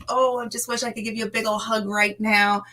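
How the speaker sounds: normal talking voice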